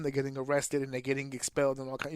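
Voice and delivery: ordinary talk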